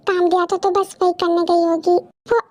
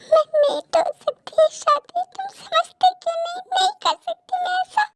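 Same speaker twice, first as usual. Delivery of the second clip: high, distressed pleading; anguished refusal; 8 semitones higher than usual. Acted. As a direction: refuse helplessly in a high, pained voice. Woman refuses him in anguish.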